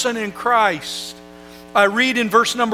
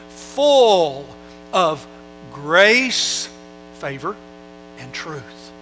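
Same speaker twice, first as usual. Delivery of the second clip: slow drawn-out emphasis; 3 semitones lower, much slower than usual. Acted. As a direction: linger on every single word.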